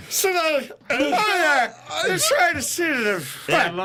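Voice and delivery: put-on high, slow drunk babble; mock drunk. Friend teasing speaker for sounding tipsy, mock drunk. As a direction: slur it loosely like a drunk. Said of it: "You sound like you just started getting drunk".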